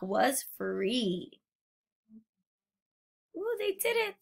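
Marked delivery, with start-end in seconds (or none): 3.4-4.2 much higher, softer delighted ooh. Real, not acted. Truck freed, soft high delight for the children.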